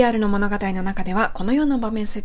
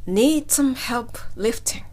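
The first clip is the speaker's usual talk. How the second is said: slow, careful teacher model; slow model line; much slower than usual. Shown as a demonstration. Teacher modeling the paramedic's line, slow. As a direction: spell it out slowly for learners. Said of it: "はい、ここですね".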